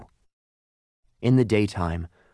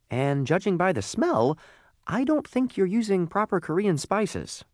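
high-pitched little coal voice, doubting; small high voice, politely critical; talking lump of coal, critical; 10 semitones higher than usual. Acted. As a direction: point out the fault, pitched well up. Lump of coal's voice.

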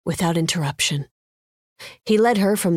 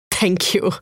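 put-on thick Indian accent; 3 semitones higher than usual. A narrator's reading. Winner thanking the casino manager, thick Indian accent. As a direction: speak with a heavy Indian accent. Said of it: "she said in a thick Indian accent".

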